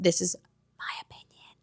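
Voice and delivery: barely audible whisper; hushed, voiceless fine print; whispered disclaimer. Real, not acted. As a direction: drop to a tiny whispered aside. Hushed disclaimer slipped into the review.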